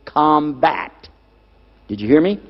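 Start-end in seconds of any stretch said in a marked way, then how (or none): 0.0-0.9 "combat" set apart as a key word; "combat" said deliberately on its own; stress on combat. Real, not acted. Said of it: "underline this word".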